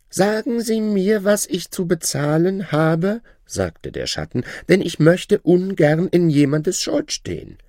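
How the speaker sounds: high, proud voice of a boastful shadow; shadow's voice. Acted. Shadow bragging to his old master, high voice. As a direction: lift the voice high and show off new wealth.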